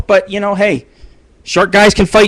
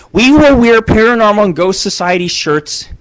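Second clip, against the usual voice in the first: ordinary delivery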